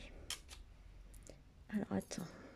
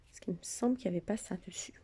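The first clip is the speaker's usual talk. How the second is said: ordinary talk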